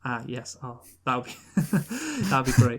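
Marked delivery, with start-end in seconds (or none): 1.6-2.2 high laugh. Real, not acted. Laughing at accent idea.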